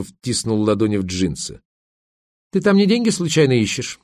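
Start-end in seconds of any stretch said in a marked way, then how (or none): 2.5-4.0 higher-pitched wry taunt; mocking smirk. A narrator's reading. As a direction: needle him with a smirk. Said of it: "усмехнулась Верка". (с насмешкой)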